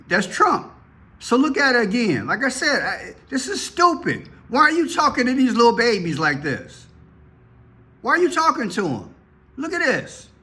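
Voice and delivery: high-pitched exasperated protest; indignant. Real, not acted. Commentator objecting to children being interviewed, indignant. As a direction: argue it in a high, incredulous voice.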